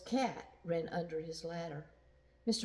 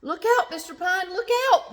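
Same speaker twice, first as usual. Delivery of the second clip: high, loud warning shout; calling out; 12 semitones higher, 11 dB louder, faster than usual. Acted. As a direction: yell the warning up the ladder. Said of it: "the boys called".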